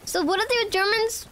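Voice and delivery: normal talking voice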